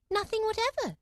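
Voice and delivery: put-on high, firm girl's answer. A narrator's reading. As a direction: insist like a stubborn girl. Alice answers again.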